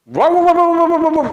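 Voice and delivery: high-pitched angry gibberish rant; mock angry yelling. Pastor showing children an angry yeller, mock shouting. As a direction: imitate someone yelling at people. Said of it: "teriak-teriak, meneriaki orang lain".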